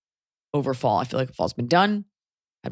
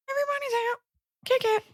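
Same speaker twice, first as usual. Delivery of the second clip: hype voice; 4 dB quieter, much faster than usual. Pretend. High hype intro.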